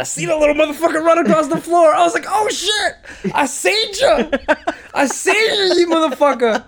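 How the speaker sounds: high, loud excited yelling; excited shout. Retold or imitated. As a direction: shout it through laughter. Excited retelling, rat spotted.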